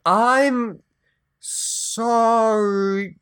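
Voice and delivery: forced out apology, strained; struggling to say it. Acted. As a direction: choke the word out. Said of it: "is sorry a hard word to pronounce with your mouth".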